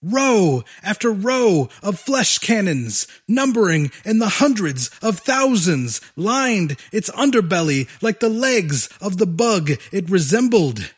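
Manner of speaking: usual speaking voice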